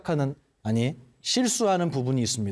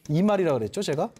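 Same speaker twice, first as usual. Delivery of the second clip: ordinary talk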